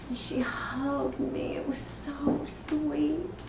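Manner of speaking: very slow, soft, drawn-out tenderness; tender reminiscence. Acted. Mother recalling her daughter's hug, slow tender reminiscence. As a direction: savour the memory, softly stretched.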